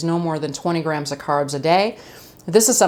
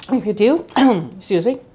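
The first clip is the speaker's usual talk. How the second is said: mouth full; 4 semitones higher than usual. Real, not acted. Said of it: "Trying to talk with my mouth full".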